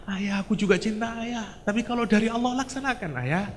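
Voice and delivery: a son softly yielding; tearful son. Acted. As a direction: answer through tears, accepting. Son answers through tears.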